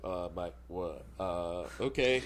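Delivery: slow, low, halting uncertainty; baffled but going along with it; hesitant reply. Retold or imitated. Actor reacts to being cast as her husband, hesitant. Puzzled actor accepting odd casting.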